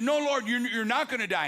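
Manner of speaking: imitating followers who refuse to accept it; urgent protest. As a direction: retell the disciples' alarmed protest. Disciples protest at Jesus' words.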